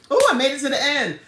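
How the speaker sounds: high, loud, rushed whoop of triumph; excited cheer. Real, not acted. Streamer celebrating making it to the end, excited cheer.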